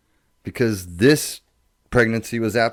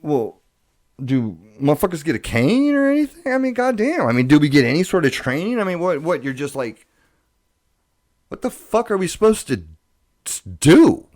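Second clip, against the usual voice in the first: exasperated question; 7 semitones higher, 5 dB louder than usual. Retold or imitated. His panicked question to doctors.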